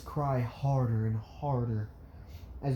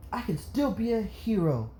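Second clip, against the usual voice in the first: put-on high-pitched, hopeful child voice; hopeful little boy; 8 semitones higher than usual. Izuku to his mom after crying, hopeful child. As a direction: pipe up with new resolve.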